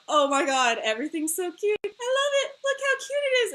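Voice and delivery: high, squealed fangirl gushing. Acted. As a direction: start gushing and climb into a squeal. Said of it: "this, like, whiplash of".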